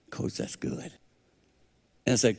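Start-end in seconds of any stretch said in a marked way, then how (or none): 0.1-0.9 breathy whisper, much softer; hushed reply. Retold or imitated. Answering Wooden in a moved near-whisper.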